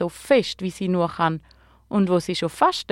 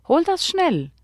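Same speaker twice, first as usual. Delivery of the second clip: high, eager urging; delighted, high-pitched storybook plea; beaming delight; 10 semitones higher than usual. A narrator's reading. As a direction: send them off excitedly. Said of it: "strahlt der Gabriel".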